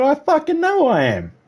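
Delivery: high-pitched exasperated cry; retold cursing outburst. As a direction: throw it back in mock outrage, voice shooting up. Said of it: "I was swearing and cursing".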